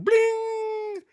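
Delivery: high, loud drawn-out bling; long high-pitched chime; imitated notification bling. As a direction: ring out like an alert. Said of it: "they're going".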